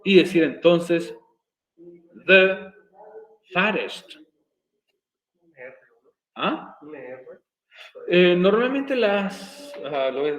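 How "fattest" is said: "fattest" with the middle T tapped like a Spanish R; American flapped T. Shown as a demonstration. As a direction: say "fattest" American-style, T as a quick R. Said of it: "como si fuera una R".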